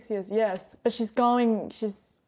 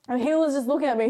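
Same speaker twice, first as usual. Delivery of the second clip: normal talking voice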